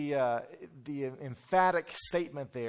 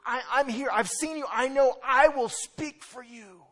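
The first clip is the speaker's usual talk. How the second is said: high, loud pleading to be chosen; eager volunteer; 11 semitones higher, 10 dB louder than usual. Acted. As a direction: plead like a child desperate to go. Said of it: "that's what Isaiah is saying".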